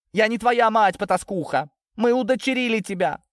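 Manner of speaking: high, furious outburst. A narrator's reading. Lidia telling Natasha she was adopted, furious. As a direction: hurl the revelation at her. Mother disowning daughter in quarrel.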